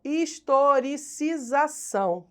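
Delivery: historicização sounded out slowly, syllable by syllable; slow, careful enunciation. Real, not acted. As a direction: say "historicização" slowly to get every syllable right. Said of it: "tem que falar devagar".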